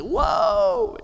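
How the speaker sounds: high, loud whoop of relief; freed man's joyful high cry; amazed whoa. Acted. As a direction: let out a soaring, astonished whoa. Debtor reacts to being freed.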